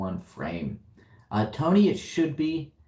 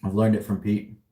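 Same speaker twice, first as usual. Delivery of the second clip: usual speaking voice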